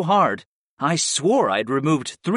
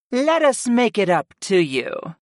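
goddess agreeing; 9 semitones higher than usual. A narrator's reading. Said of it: "Miralee agreed".

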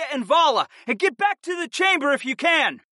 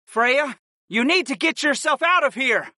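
raised, high-pitched calling out; urgent call; 11 semitones higher than usual. A narrator's reading. Husband to wife who refuses to flee, calling out. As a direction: call across the fight, pressing. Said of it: "I called out".

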